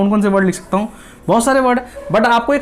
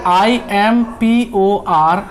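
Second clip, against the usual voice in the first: I एम P O R T A N T, each letter drawn out, very slowly; teacher spelling for the class; much slower than usual. Shown as a demonstration. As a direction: spell important letter by letter, unhurried. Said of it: "अगर मैं इसको देखूं".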